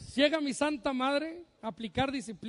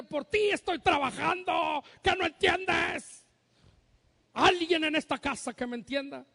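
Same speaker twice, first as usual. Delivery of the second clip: slow and louder, the last words shouted up high; hammed-up exasperated parent yelling at his son; mock angry shout; 4 semitones higher, 3 dB louder than usual. Angry parent he never was. (padre exasperado gritando, en broma)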